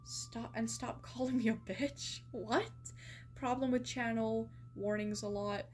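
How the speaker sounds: ordinary talk, nothing marked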